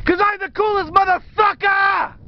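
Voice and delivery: high triumphant shout. Real, not acted. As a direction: yell it out proudly. Shouting from the rocks.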